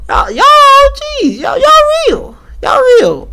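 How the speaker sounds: high, hyped squeal of praise; excited. Real, not acted.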